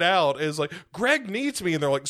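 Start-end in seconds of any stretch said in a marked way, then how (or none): 0.9-1.7 high, pleading Susie voice, mock-panicked; whiny panicked girl. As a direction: play the frantic girl in the vent.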